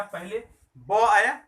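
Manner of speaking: loud, slow, each sound stressed. Shown as a demonstration. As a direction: spell it out slowly and loudly. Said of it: "बैग, ब ए". (धीमे और ज़ोर से उच्चारण)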